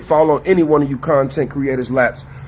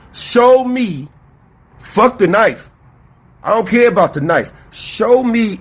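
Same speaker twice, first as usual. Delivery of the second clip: quoted demand; 6 semitones higher than usual. Retold or imitated. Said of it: "put that shit on my Facebook page".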